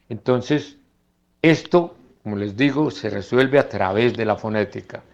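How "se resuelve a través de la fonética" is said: normal talking voice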